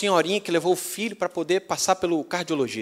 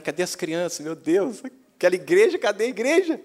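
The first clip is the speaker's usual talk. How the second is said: building from puzzled to high, loud alarm; acted panic, searching for what's vanished; increasingly urgent, bewildered questioning; mock dismay; 4 semitones higher, 4 dB louder than usual. Acted alarm at empty house.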